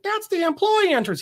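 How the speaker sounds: a quick high nurse voice at the door. Retold or imitated.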